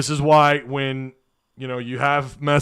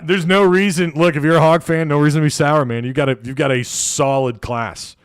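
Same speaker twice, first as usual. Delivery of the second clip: ordinary talk, nothing marked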